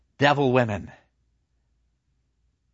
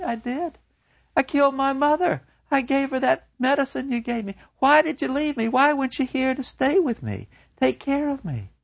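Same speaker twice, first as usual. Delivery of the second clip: put-on high, distraught girl pleading; a broken young woman begging her lover; Gretchen's high voice; 13 semitones higher than usual. To her lover, Gretchen gone mad with grief, high and pleading.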